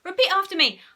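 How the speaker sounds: high, loud order; bossy, excited command; commanding hypnotist. A narrator's reading. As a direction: instruct your subject eagerly. Henry orders hypnotised Margaret.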